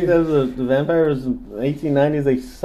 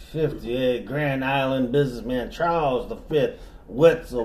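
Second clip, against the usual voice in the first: classy southern storyteller; southern accent; 3 dB louder than usual. Pretend. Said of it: "I'm the one doing the voice".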